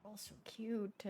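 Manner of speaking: hushed aside about cute island cats; hushed gushing. Retold or imitated. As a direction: melt quietly over the cats. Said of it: "I was like".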